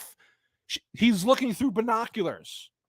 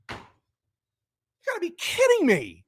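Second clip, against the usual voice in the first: incredulous; 11 semitones higher than usual. Real, not acted. Disbelief at the plot device.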